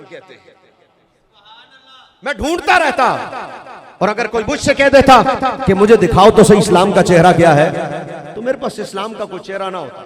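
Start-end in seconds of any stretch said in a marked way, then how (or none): 2.2-8.3 loud, forceful preaching; पुरज़ोर ख़िताबत. Real, not acted. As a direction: preach it out to the back of the mosque. (बुलंद आवाज़ में जोशीला बयान)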